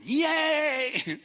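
very high, loud yay; mock cheer. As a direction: squeal of playful delight. Mock celebrating bunny milestones.

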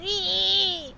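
thin, very high held "ee"; high-pitched squeak; mouse squeak. Acted. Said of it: "squeaking like a house full of mouses".

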